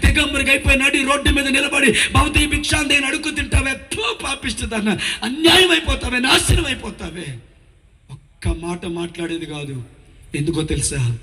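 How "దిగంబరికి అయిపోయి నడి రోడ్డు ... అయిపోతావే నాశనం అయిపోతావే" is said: put-on high-pitched furious cursing old woman; grandmother's cursing tirade. Preacher acts his grandmother cursing his mother in the street, high furious tirade. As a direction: fling curses like a wronged mother-in-law.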